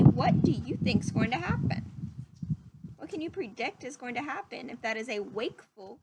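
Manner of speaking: ordinary delivery, nothing marked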